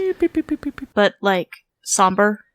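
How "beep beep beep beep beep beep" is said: high beeping imitation. Imitating game music.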